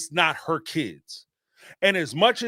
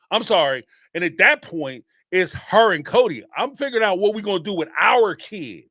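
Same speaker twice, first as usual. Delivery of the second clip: ordinary delivery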